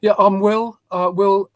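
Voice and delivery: ordinary talk, nothing marked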